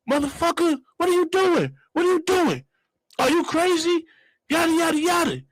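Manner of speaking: high-pitched mock tirade; blacking on him. Passenger arguing with cab driver, high acted outburst. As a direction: lay into him in a high, indignant voice. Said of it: "I just immediately blacked on him".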